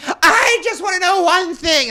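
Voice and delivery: high, loud, dragged-out mock wail; hammed-up slow drunken holler; drunk woman's demand. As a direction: imitate a drunk friend storming in. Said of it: "Doesn't she stomp in?".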